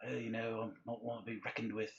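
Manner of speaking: Jason Statham impression. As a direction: lightly imitate an action star, quiet and understated. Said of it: "very distinctive, uh, speech pattern or delivery".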